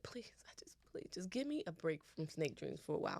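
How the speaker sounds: hushed, half-playful plea; soft aside to God; pleading prayer. Pretend. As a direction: beg under your breath. Mock prayer to God for relief.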